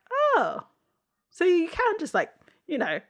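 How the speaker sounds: high, loud mock astonishment; mock amazement. Mocking the FBI agent's gloves, high mock amazement. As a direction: gush in fake wonder at the obvious.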